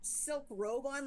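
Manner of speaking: ordinary talk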